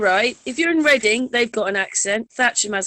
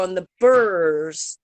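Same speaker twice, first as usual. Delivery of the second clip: Berkshire burr; 3 semitones lower, much slower than usual. Shown as a demonstration. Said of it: "Berkshire's got the burr".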